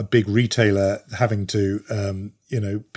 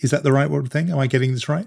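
ordinary talk, nothing marked

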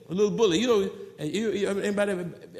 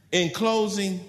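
normal talking voice

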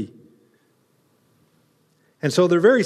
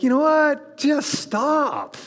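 higher, mock exasperated snap; exasperated dad; 7 semitones higher, much slower than usual. Preacher imitating a dad shutting down tears, exasperated. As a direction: cut the crying short like a fed-up father. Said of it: "dad's like".

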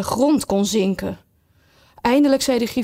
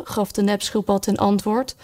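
plain reading voice

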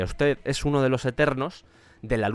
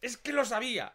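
exasperated; 8 semitones higher, 6 dB quieter than usual. Real, not acted. Groans at a bad pun.

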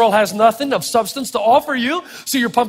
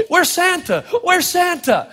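put-on a light, higher kid asking for Santa; lightly voiced excited child; a hint of a child bouncing with impatience; eager kid; 7 semitones higher, slower than usual. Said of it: "like a kid at the Christmas parade".

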